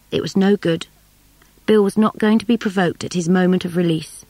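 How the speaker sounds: ordinary delivery, nothing marked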